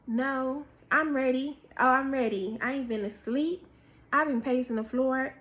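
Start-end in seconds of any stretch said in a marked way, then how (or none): none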